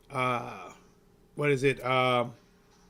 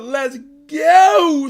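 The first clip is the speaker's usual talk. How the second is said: hype shout; 10 semitones higher, 12 dB louder than usual. Real, not acted. Hype intro for his boxing stream.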